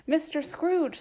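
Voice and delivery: cheerful toast. A narrator's reading. Bob toasts Scrooge.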